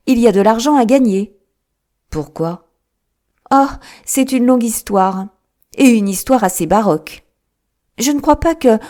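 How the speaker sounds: a hint of the keen detective, pleased with a puzzle; Holmes, lightly voiced. A narrator's reading. Holmes teasing Watson about the odd case, lightly voiced.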